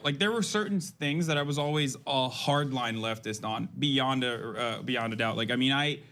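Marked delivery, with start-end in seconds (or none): none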